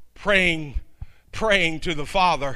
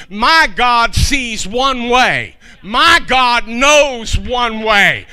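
each phrase spaced out slowly and louder; measured, pounding declaration; slow emphasis; 3 semitones higher, 7 dB louder, much slower than usual. Acted. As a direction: hammer every word deliberately. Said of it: "slow emphatic triple repetition".

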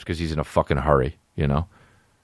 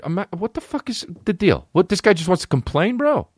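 high, incredulous complaint; exasperated rant; 11 semitones higher than usual. Retold or imitated. Comic retells yelling about the other driver's complaint, high and exasperated. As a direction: throw up your hands at the guy. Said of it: "And I said".

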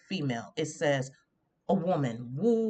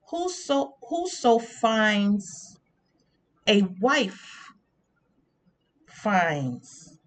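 scripture recitation; much slower than usual. Acted.

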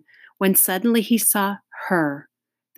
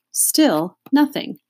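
ordinary delivery

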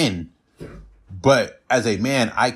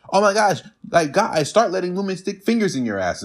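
imitating a gushing woman, higher; high, gushing mock of a woman pushing him; mocking woman's voice; 7 semitones higher than usual. Mimicking women's advice.

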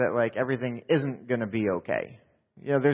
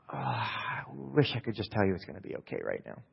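low, subdued and wistful; softly, as if unable to give the comfort; pained reluctance; 5 semitones lower, 7 dB quieter than usual. Retold or imitated. Wanting to reassure someone but unable, low and reluctant. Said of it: "And I go".

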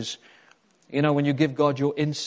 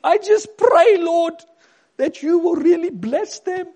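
high, loud mock prayer in character; hammed-up neighbour praying at full volume; Magda; 12 dB louder than usual. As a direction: pray loudly in a high caricature voice. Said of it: "I could imagine Magda baking those cakes".

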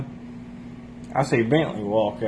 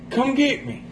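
high-pitched imitation of a pleading friend; retold phone plea; 13 semitones higher than usual. Friend phoning for a ride, high mimicked plea. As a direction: mimic his plea in a much higher voice.

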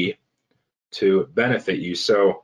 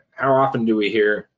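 ordinary talk, nothing marked

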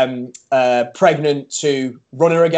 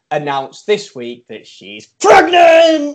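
loud, high, slow big reveal; excited announcement; 6 semitones higher, 6 dB louder, much slower than usual. Real, not acted. Announcing friend's pregnancy.

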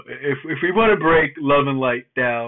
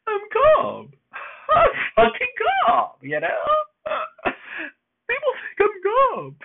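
much higher, laughing through the words. Retold or imitated. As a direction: crack up while insisting you're calm. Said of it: "I just started laughing".

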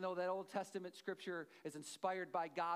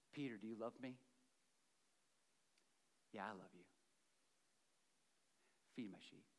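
lower, softer tender exchange; tender dialogue; 8 semitones lower, 8 dB quieter, faster than usual. Acted. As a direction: speak it gently, like a quiet confession.

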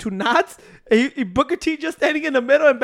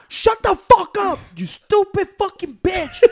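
angry curse; 6 semitones higher, slower than usual. Retold or imitated.

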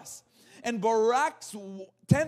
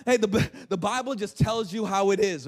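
ordinary talk

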